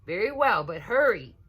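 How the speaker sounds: put-on mother rushing the kids; impatient mother voice; busy mother. A narrator's reading. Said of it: "said Missus Alligator".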